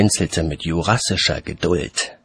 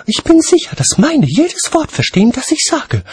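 high, loud, indignant lady voice; hissing lady; 6 dB louder than usual. A narrator's reading. Lady defending her dragons to watchman, high forceful hiss. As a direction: hiss it with raised pitch and force. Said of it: "zischte Lady Cassidy".

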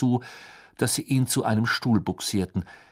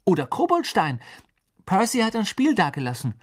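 high, eager follow-up offer; hasty suggestion; 9 semitones higher, 4 dB louder than usual. A narrator's reading. Ron offers another game to dodge argument, hasty. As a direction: keep offering alternatives in a raised pitch. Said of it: "sagte er hastig".

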